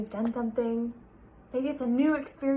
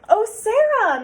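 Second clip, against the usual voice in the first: much higher, loud gushing greeting; gushing principal; 11 semitones higher, 12 dB louder than usual. Acted. The principal greeting Sarah at the car, high and gushing. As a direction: gush over her. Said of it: "Missus Burton gushed".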